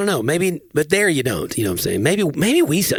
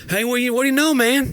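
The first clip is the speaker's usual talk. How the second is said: higher, hearty Southern greeting; Southern mechanic voice; 12 semitones higher than usual. Retold or imitated. Host imitating auto shop guys' Southern greeting. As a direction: greet like a good old boy. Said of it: "they're like".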